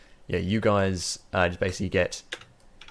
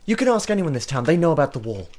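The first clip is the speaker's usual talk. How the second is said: acted complaint, climbing higher as it goes; exasperated cleric; 7 semitones higher than usual. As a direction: stress each word in weary disbelief. Cleric complains about talkative dwarf.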